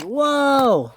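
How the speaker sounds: high, drawn-out "whoa"; alarmed cry. A narrator's reading. Ferdinand about to land on his roof, alarmed cry. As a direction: wail it as you fall.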